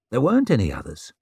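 ordinary delivery, nothing marked

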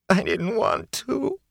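high, slow, tearful plea; crying. Acted. Filthy prisoner breaking into tears before the lord, high and tearful. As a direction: sob it out like a broken man. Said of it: "Fain began to cry".

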